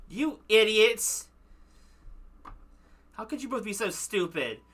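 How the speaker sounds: angry scolding. Acted. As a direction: drag out the insult in anger.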